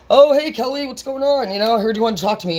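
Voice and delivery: high, bright-eyed God greeting; cheery God voice. Acted. God greeting Kelly who wanted to talk, in a high cheery voice. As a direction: play God dropping by casually.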